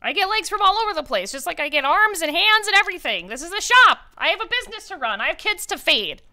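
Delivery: high, loud squeaky protest; indignant kobold. Acted. Kobold insulted by a lowball offer, high and squeaky. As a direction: squeak back offended like a small kobold. Kobold defends his prices.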